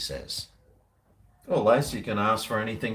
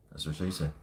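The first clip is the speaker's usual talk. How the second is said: low, soft deadpan aside; muttered punchline; 7 semitones lower, 9 dB quieter than usual. Acted. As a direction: drop it under your breath, dry. Deadpan joke after chat line.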